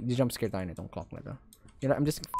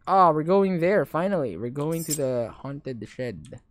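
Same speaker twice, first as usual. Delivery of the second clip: normal talking voice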